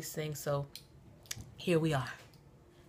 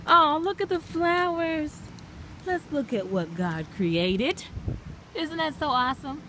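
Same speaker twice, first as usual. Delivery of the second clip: coaxing mom; 8 semitones higher than usual. Pretend. Coaxing son outdoors.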